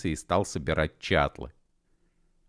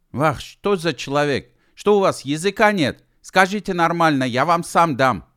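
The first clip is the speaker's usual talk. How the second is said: much higher, loud outraged protest; indignant; 10 semitones higher, 7 dB louder than usual. Acted. Gedevan protests at U Ef snatching the mirror, loud indignation. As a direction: scold him for grabbing the mirror. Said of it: "возмутился Гедеван".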